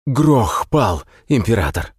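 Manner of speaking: out of breath, desperate report; urgent panting report. A narrator's reading. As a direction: gasp it out, high and urgent. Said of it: "захлёбываясь дыханием".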